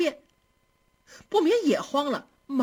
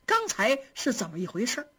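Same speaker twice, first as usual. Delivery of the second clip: usual speaking voice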